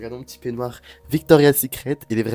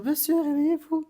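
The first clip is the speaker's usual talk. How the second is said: nurse waking patient. Retold or imitated.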